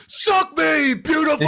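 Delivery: high, drawn-out mock yell; hammed-up, high-pitched holler; Stifler shout. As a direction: belt it out like Stifler running up. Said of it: "I love when Stifler would go".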